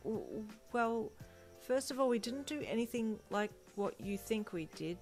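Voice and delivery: evasive. A narrator's reading. Denying it to nosy friends, evasive. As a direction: hedge your way out of it. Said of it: "you said evasively".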